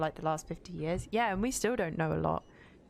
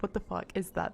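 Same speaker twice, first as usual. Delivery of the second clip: usual speaking voice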